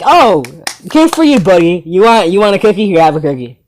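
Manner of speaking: exaggerated patronizing enthusiasm; sarcastic praise. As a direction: talk down like rewarding a little boy. Mock praise of bragging kid.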